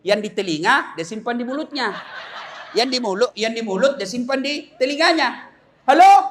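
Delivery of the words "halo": loud, high questioning hello; imitated comic phone yell; shouting into phone. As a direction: holler as if nobody hears you. Acted shouting into upside-down phone.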